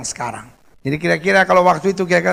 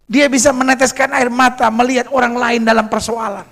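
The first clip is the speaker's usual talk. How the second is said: raised, high, urgent sermon voice; impassioned preaching; 5 semitones higher, 6 dB louder than usual. Real, not acted. Preacher urging prayer for troubled friends, impassioned preaching. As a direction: press the appeal loudly on the congregation.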